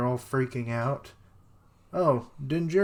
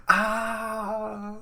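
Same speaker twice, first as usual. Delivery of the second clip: short high yelp; a yelp of pain; mock scream; 10 semitones higher than usual. Character screams in the pot.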